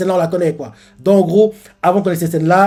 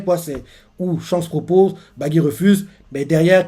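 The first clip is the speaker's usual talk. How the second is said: ordinary delivery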